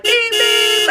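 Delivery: high, loud beep beep like a car horn; mock car horn beeps; car horn imitation. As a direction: honk it out high. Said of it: "there was horns that were like".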